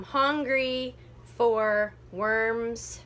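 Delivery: slow, drawn-out beats; counting out syllables. Shown as a demonstration. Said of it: "too many".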